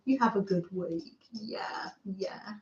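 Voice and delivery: soft unvoiced whisper. Real, not acted. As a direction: whisper the goodbye. Whispered farewell to viewers.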